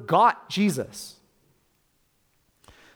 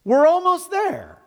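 squeaky hopeful cartoon cry; high, slow cartoon voice; 13 semitones higher, 5 dB louder, slower than usual. Preacher echoing the cartoon line to his congregation, high and slow. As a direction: stretch out almost, high and sing-song.

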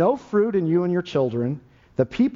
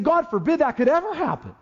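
a much higher, raised cry of horror; outraged protest; 10 semitones higher than usual. Retold or imitated. Preacher voicing the horrified listeners in a raised cry. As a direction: fling it out as a shocked refusal. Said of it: "They said".

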